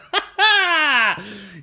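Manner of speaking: a high, loud, real laugh; burst of laughter. Real, not acted. Owner laughing at his burnt DVD case, high loud laughter.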